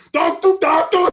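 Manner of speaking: high, full-volume comic yell; mock German yelling. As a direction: bellow it like an over-the-top German. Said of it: "I couldn't not yell".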